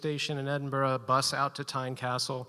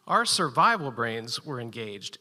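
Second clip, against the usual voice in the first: usual speaking voice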